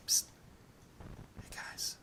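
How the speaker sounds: furtive stage whisper; hushed, conspiratorial call. Acted. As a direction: whisper to get friends' attention secretly. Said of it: "Why are you whispering?".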